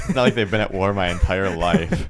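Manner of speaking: talking through laughter; laughing. Real, not acted. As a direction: laugh while getting the words out. Joking about America's wars.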